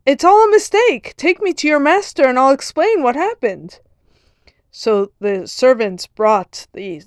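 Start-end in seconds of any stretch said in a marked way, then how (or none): 0.1-3.8 high, loud panicked shouting; screaming protest. Retold or imitated. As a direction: yell to stop the servants. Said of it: "the farmer started to scream".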